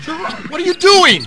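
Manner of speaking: high, loud scolding cry; alarmed shout. Acted. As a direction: yell in shock to stop him. Assistant alarmed at the director.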